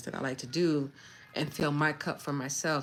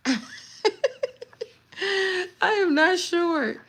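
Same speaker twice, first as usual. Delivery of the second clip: much higher, slow, half-laughing protest; laughing whine; 14 semitones higher, 3 dB louder, slower than usual. Real, not acted. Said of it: "repeats denial, laughing".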